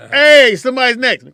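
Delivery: high, loud shout from the crowd; heckling yell. Retold or imitated. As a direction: yell it at the comic to get off. Mimicking an impatient heckler.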